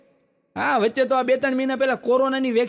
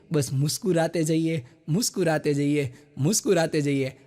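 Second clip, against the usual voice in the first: lower, softening repeated refrain; fading refrain; 5 semitones lower than usual. Acted. Comedian ending on the couplet, lower fading refrain. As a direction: let the refrain fade gently.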